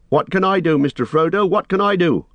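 high-pitched, urgent call; Sam shouting. A narrator's reading. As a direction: shout down, desperate to help. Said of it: "shouted Sam".

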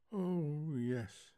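soft, sleepy, drawn-out reply; drowsy recall. A narrator's reading. Wife dimly remembering the girl, drowsy and soft. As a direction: let it drift out quietly.

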